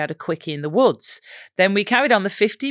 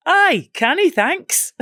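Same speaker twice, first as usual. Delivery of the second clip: high-pitched put-on Geordie; bright-pitched, loud mock Newcastle accent; 12 semitones higher, 3 dB louder, slower than usual. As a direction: boyfriend calling out in hammed-up Geordie. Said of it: "concentrating on my Newcastle accent".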